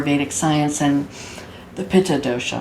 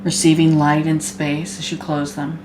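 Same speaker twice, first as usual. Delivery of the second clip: guided meditation. Acted. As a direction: guide them gently into body awareness, unhurried.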